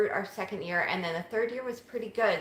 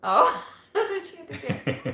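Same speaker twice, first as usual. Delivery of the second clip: laughing; 11 semitones higher, 7 dB louder than usual. Real, not acted. Laughing at a joke about a viewer.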